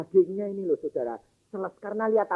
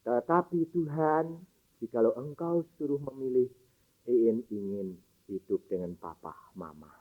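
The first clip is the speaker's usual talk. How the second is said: put-on child's faint prayer; 8 semitones lower, 7 dB quieter than usual. Sick daughter tells God she wants to live with her parents, faint and soft. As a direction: pray simply and weakly, like a sick child.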